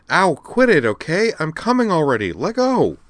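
loud high complaint while being dragged; protesting yelps. Acted. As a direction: whine and yelp at a brother. Ear pulled, complaining.

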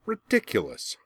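high scornful retort; scornful snap. A narrator's reading. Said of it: "Azula snapped".

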